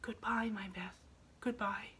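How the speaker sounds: tender breathy farewell whisper; barely voiced, saying goodbye gently; soft whisper. A narrator's reading. Mother whispering goodbye to her sick daughter, tender whisper. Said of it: "softly whispered".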